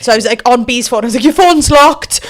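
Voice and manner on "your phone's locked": high, loud panicked blurt. Retold or imitated. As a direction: squeal it in a panic. Retelling panicked phone moment.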